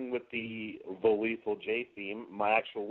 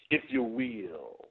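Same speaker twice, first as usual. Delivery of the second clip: very slow, drawn-out "if you will"; slow Dusty tag; much slower than usual. Shown as a demonstration. Wrestler demoing Dusty's slow sentence ending. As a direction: stretch every word slowly. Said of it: "with it being really slow".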